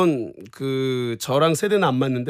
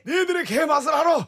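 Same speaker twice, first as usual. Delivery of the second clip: put-on raised, shouted old actor's line; Shin Goo impression; 12 semitones higher than usual. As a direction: bark it out like the old man in the ad. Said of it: "신구 선생님이".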